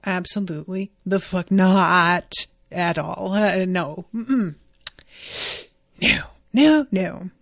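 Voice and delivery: loud, appalled refusal, drawn-out "not"; horrified, emphatic no. Real, not acted. Emphatic refusal of gross scene.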